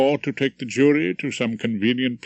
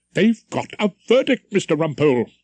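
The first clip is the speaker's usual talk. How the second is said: higher, formal announcing call; intoning usher; 6 semitones higher, 4 dB louder than usual. Acted. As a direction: announce the verdict is in. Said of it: "the usher intoned".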